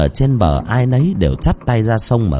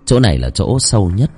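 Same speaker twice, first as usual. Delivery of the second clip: ordinary delivery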